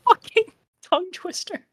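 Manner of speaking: laughing through the words; cracking up mid-word. Real, not acted. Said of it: "I can't even talk".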